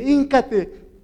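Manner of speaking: loud, high, forceful retold order; authoritative command. Woman telling Toño to kneel, authoritative and loud. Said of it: "una mujer con autoridad".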